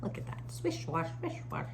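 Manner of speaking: hushed whisper. A narrator's reading. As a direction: hush it as it sways. Whispered dance moment.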